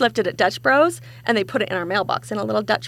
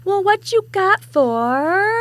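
mock announcer voice; 9 semitones higher, 4 dB louder, much slower than usual. Podcast intro, mock dramatic voice.

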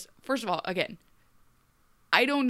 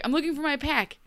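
higher, earnest mock declaration; earnest Ruby; 7 semitones higher, faster than usual. Ruby telling Mulan her goal, higher earnest mock. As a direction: announce your quest a touch too sincerely. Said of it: "she's like".

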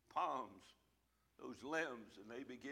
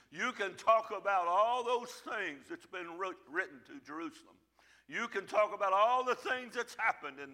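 preaching; 6 semitones higher, 6 dB louder than usual. Real, not acted. Preaching to congregation.

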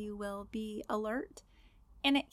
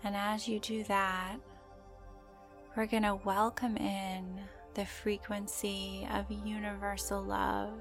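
guided meditation; slower than usual. Acted. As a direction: gently guide the listener into calm.

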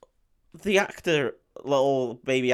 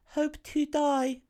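put-on little girl; 11 semitones higher, much slower than usual. Newt answers Ripley morbidly, in a high slow kid voice. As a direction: drag it out eerily like a small child. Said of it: "the girl just looks and goes".

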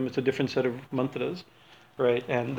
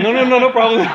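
laughing reassurance; 10 semitones higher, 11 dB louder than usual. Retold or imitated. Backing down to the priest.

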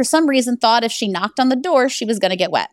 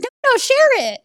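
much higher, quick eager quote; eager retold agreement. Guest agreeing to share the fall, eager and high. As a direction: jump up high, imitating her yes.

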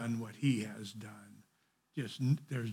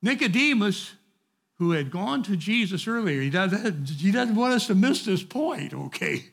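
higher and louder, driving the point home; fervent preaching; 5 semitones higher, 10 dB louder than usual. Real, not acted. Preacher telling his class not to put people down. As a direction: lift up the average person.

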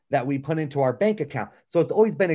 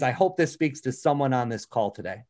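ordinary talk

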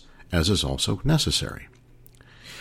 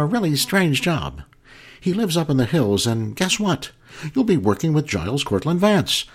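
gushing agent; 6 semitones higher than usual. Retold or imitated. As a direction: coo over him like a flattering agent. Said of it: "it went a little something like this".

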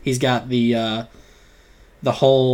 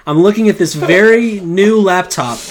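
usual speaking voice

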